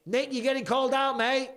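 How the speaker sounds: loud, high-pitched shout across the house; calling out. Real, not acted. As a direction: holler for someone out of the room.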